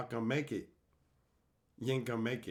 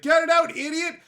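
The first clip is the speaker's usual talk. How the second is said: high, loud frantic shout; panicked yell; 12 semitones higher, 8 dB louder than usual. Retold or imitated. Voicing his own body telling him to spit out the hot pepper, high frantic yell. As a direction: scream it like your mouth is on fire.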